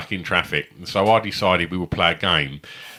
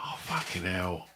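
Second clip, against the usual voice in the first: weary groan; 11 dB quieter than usual. Retold or imitated. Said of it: "And I was like".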